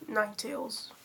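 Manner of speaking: normal talking voice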